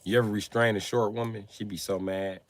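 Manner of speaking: ordinary delivery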